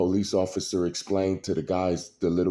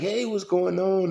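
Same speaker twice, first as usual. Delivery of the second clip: confused wino; 11 semitones higher than usual. Retold or imitated. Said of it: "and he like".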